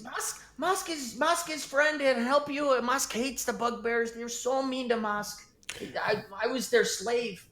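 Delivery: high, halting goblin talking about himself; scared goblin begging the party to trust him; timid goblin voice. Acted. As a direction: plead nervously in a high little goblin voice.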